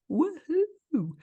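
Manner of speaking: high-pitched, drawn-out woohoo; celebratory cheer. Acted. Host cheering an alcohol-free Christmas to co-host, celebratory whoop.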